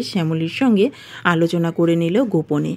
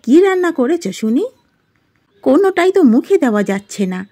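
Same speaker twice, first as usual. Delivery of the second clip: higher-pitched, indignant mother-in-law voice; scolding mother-in-law; 5 semitones higher, 4 dB louder than usual. Acted. Mother-in-law rejecting her daughter-in-law's cooking, scolding. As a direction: complain sharply, pitch leaping up on the questions.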